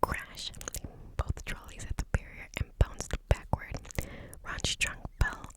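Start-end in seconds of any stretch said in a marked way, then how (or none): none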